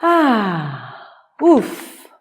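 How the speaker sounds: grunting troll exclaiming; a troll's heavy grunt. A narrator's reading. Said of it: "un troll a dit". (troll qui grogne)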